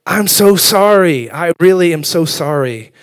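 over-apologize, stretching out the words; hammed-up pleading for forgiveness; effusive apology. Acts out apology to upset wife.

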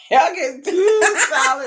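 loud high laughing shout. Real, not acted. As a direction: shout it while cracking up. Repeats her line laughing hard.

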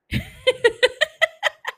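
loud high cackle that rises; high laugh. Real, not acted. Laughing at leaving silences for effect, loud high cackle. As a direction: crack up laughing hard. Cracking up at her own pauses.

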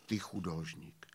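deep quiet echo of the verse; low, soft answer line. Acted. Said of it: "odpověděl taky básní".

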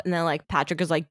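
normal talking voice

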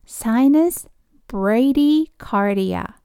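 "sinus bradycardia" spelled out slowly, every syllable clear; slow model pronunciation. Shown as a demonstration. Teacher slowly models "sinus bradycardia" for nurses. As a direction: say "sinus bradycardia" slowly so learners can repeat. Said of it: "一緒に発音していきましょう".

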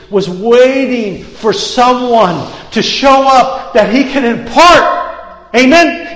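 fiery preacher building to a shout; preaching at full force. Acted. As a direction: drive it home to the congregation.

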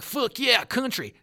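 high, loud country holler; mock festival whoop. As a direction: whoop it like an arriving festival crowd. Retold festival arrivals yelling.

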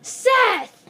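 high, loud, laughing scold; exasperated scolding. Real, not acted. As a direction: call him out, half laughing. Scolding friend's loud chewing.

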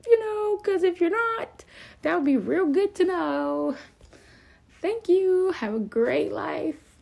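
sing-song it like a breezy note to an ex; high, falsely cheerful sign-off to the ex; fake sweet email. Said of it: "just be like".

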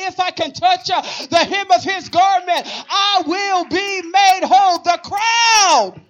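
high, driving preacher voice building to shouts; full-force preaching. Real, not acted. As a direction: build each phrase to a loud punched cry.

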